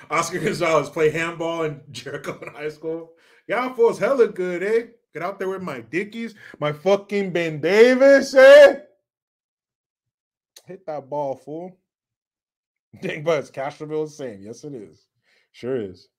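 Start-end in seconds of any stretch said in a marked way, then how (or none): 3.5-11.8 Chicano player bragging, building to a shout; Chicano homeboy accent. Acted. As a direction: swagger in a sing-song Chicano accent. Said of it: "I can do that accent so well".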